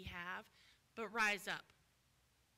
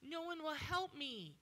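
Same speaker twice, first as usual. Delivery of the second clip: higher, louder self-pitying lament; the lame man's complaint; 6 semitones higher, 4 dB louder than usual. Retold or imitated. Man at the pool complains nobody helps him, pitiful. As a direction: moan it like the man who waited years. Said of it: "this man laying here for thirty-eight years".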